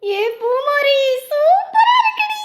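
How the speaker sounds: high, loud squeals of wonder; excited delight. Acted. Child marvelling to a friend at so many flowers, squealing with excitement. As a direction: gush with girlish excitement.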